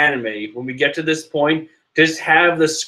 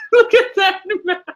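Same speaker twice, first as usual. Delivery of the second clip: talking through real laughter; laughing; 12 semitones higher than usual. Real, not acted. Fan laughing at Big Mom's mouth while talking.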